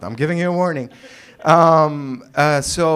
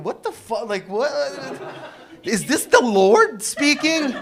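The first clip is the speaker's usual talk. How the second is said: baffled reader; 8 semitones higher, 4 dB louder than usual. Retold or imitated. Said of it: "I'm reading them and I'm going".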